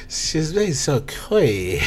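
drawn-out mock French lover; lightly voiced French lover; slow French creep. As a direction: savor each word like a French creep. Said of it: "he goes".

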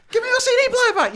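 high, loud, fast yell after a thief; shouting at the thief to hand it back; chasing shout. Retold or imitated. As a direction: bellow it while running after him.

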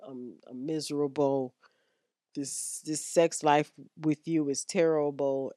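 mild sad complaint. Retold or imitated. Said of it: "she just sitting there looking at you like".

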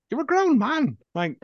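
high, pleading exasperation aimed at the screen; exasperated rant. Retold or imitated. Telling the sulking film hero off, exasperated. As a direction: spell it out like to a sulky child, slowly at the end.

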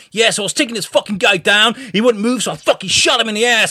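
hammed-up action-story boast; mock cop bragging. Imagined cop boasting on podcast.

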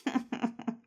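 high, quick snicker; mocking laugh. A narrator's reading. Noggin laughs at Kindness's mangled tail, mocking laugh. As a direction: laugh at someone's expense. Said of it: "began to laugh".

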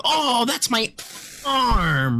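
high, drawn-out mock cry of pain; imitating Gilbert. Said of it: "why do you make me sound like that".

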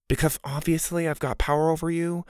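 lightly voiced smug friend; cocky boy. A narrator's reading. Mac, cocky friend, voiced in story.

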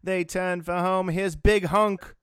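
high, slow, drawn-out race caller; Trevor Denman race call. Retold or imitated. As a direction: call the horse home like a track announcer. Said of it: "did you give the call".

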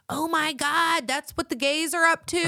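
high, prim scandalized gasp; Karen voice. Retold or imitated. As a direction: clutch your pearls like a Karen. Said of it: "I always have these Karen moments".

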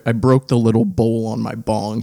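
ordinary talk, nothing marked

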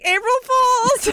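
high, drawn-out playful shout; gleeful child prankster. Retold or imitated. Imitating her daughter revealing a snack-time prank, gleeful and high. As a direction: imitate a kid springing the big reveal. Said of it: "she'll be like".

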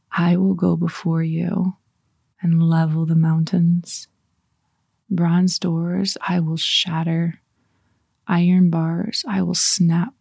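slow, measured verse reading; meditative scripture reading. Shown as a demonstration. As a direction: savor each phrase, pausing after it. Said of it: "I'm gonna read it again".

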